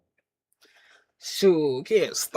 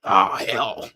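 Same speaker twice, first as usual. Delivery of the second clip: low, slow "oh, hell"; mock low-voiced resignation; imitated boss grumble; 12 semitones lower than usual. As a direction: say it as the beaten boss, pitched way down. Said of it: "He'd be like".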